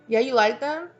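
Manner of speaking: high, prodding check; acted high-pitched leading question; manipulative friend. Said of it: "a type of friend or something".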